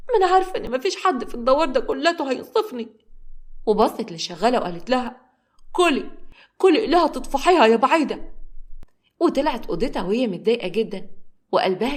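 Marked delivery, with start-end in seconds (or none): 0.1-2.9 high-pitched wounded indignation; resentful. A narrator's reading. Wronged woman answering the man who walked in, resentful. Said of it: "نوارة بصت له بغيظ". 5.7-8.3 high, loud spiteful command; resentful. A narrator's reading. Wronged woman telling the maid to eat it, resentful. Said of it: "نوارة بصت له بغيظ".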